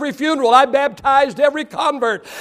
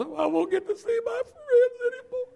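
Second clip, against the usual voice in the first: mock moaning; 13 semitones higher, 9 dB quieter than usual. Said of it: "heard a few moans and a few groans".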